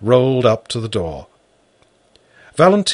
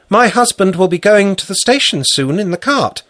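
higher, louder woman's voice; 9 semitones higher, 5 dB louder than usual. Acted. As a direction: speak as the farm wife. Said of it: "said Valentina".

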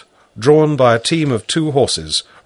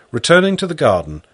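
usual speaking voice

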